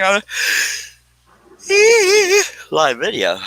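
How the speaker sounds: words stretched by a yawn. Real, not acted. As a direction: yawn right through it. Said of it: "yawn came out".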